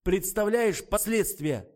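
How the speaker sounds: high, loud agitated rant; panicked anger. A narrator's reading. Adman fears the scandal getting out, agitated.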